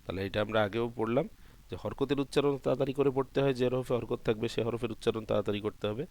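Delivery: ordinary talk, nothing marked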